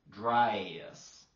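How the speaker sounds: "dryas" drawn out in a Georgia accent; stretched Georgia "dress", loud and long. Shown as a demonstration. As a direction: split the vowel of dress three ways, Georgia style. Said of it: "which they will split into".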